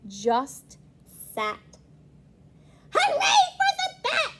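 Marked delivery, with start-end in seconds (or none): 2.9-4.3 high cheering shout; shouting hooray. A narrator's reading. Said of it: "shouted the witch".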